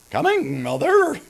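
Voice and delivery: high voice cracking on mother; cracking teenager voice. Retold or imitated. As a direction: jump up into a squeaky crack on mother. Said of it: "a teenager whose voice cracked".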